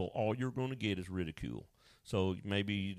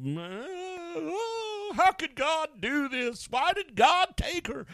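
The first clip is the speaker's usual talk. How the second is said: mock wailing; 5 dB louder, much slower than usual. Mourners wailing at the funeral.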